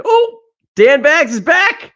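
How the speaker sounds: alto e lento, come un annunciatore che presenta un ospite; mock announcer. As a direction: annuncialo come in un ring, trascinando le parole. Announcing a returning friend.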